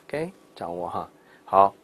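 ordinary delivery

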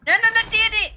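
high shocked cry; alarmed. Real, not acted. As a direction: yelp it in alarm. Dismay in game.

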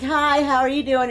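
imitating a friendly hello, high-pitched; friendly greeting. Player quoting Trixie being friendly to the monster, friendly. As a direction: lift the voice into an innocent, friendly hi. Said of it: "being his iconic self and being friendly".

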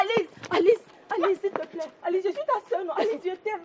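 high, softened begging; desperate pleading. Acted. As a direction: coax a sister with urgent little pleas. Begs sister repeatedly as she refuses.

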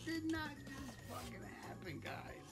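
shocked laughter. Real, not acted. Laughing disbelief at play.

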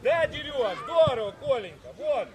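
loud cheering from the sideline; shouted praise. Real, not acted. Coach praising players mid-game, shouting. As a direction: urge them on at full voice.

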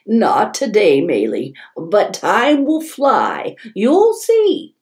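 voiced grandma. Grandma's voice in story.

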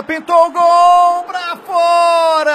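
slow stretched loud shout. Real, not acted. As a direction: shout the miss out long. Near miss live.

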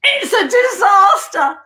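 loud, high, drawn-out mock wail; apoplectic. Guest retelling a shock on the scales, apoplectic. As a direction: cry out in hammed-up horror. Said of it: "it sent me into apoplexy".

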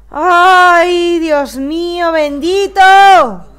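loud high exasperated cry, drawn out; panicking gamer. Real, not acted. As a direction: wail it in gamer despair. Team losing badly.

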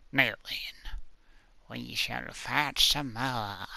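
slow, sinister whisper; menacing whisper. Acted. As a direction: whisper it like a villain. Villainous plotting before the fight.

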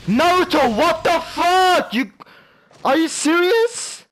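very high, loud, drawn-out rage; real gamer meltdown, stretched high yells; enraged shouting. Real, not acted. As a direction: scream slowly at the game in disbelief. Gamer rages at lost match.

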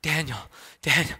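imitating a winded boy gasping a name; mock panting, one word at a time; out of breath. Winded son running up to his parents, acted out of breath. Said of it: "he's just out of breath".

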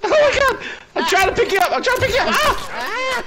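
high, panicked, laughing as well; a panicked, laughing rush to revive; frantic. Real, not acted.

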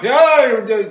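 sudden loud high shout; loud shop-floor holler; deli yell. Retold or imitated. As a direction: yell it across the deli counter. Italian deli workers yelling across.

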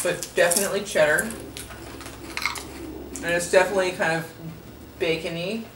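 chewing while talking; talking behind hand; mouth full. Real, not acted. Seen 0: Woman gestures first, then eats chip and chews behind her hand.

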